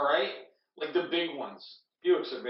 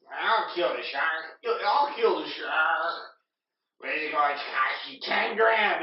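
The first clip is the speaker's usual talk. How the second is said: Salty Dog; 4 dB louder than usual. Retold or imitated. Acts out Salty Dog's offer.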